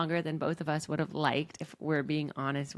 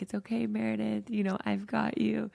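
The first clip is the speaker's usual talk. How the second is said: soothing yourself like a mother; tender self-talk, raised pitch; motherly self-comfort; 6 semitones higher than usual. Retold or imitated. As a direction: gently reassure yourself, a little higher. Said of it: "mothering myself".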